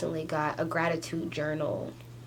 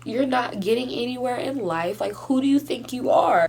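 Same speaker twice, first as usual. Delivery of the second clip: higher and louder through real tears; real crying, much higher and louder; 7 semitones higher, 5 dB louder than usual. Real, not acted. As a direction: cry it out, voice pitched up and breaking. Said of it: "I'm face masking while I'm crying".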